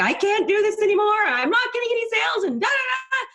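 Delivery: high, strained wailing plea; mock tearful wail; retold bawling. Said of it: "she is just bawling".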